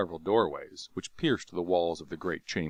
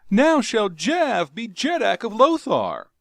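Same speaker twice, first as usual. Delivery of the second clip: high, loud, gloating proclamation; gloating triumph; 12 semitones higher, 10 dB louder than usual. Acted. Jav crowing to himself over the Jeddak. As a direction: crow it out in triumph.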